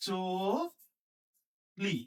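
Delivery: চলি as a long "cho", pause, "li"; stretched model pronunciation. Shown as a demonstration. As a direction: hold the o of চলি, then add li. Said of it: "আমরা বলি".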